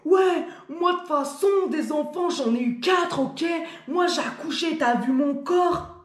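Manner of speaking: put-on drunk woman voice.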